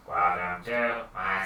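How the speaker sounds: low, slow, weak greeting; feeble voice. Acted. Wizard stuck in a wheelchair greets visitors, feeble. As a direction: eke it out softly and feebly. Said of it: "feeble sounding voice".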